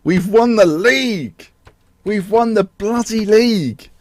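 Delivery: high, slow, exultant; elated. Real, not acted. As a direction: stretch it out in triumph. Celebrating league win.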